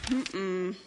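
ordinary delivery, nothing marked

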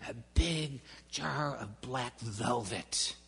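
usual speaking voice

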